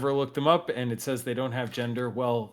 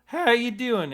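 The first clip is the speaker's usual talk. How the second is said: louder, higher, delighted folksy greeting; Thorn; 8 semitones higher, 7 dB louder than usual. Acted. Thorn recognizes Petal at the ceremony, in a delighted folksy voice. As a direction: greet her like a long-lost friend. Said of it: "he goes".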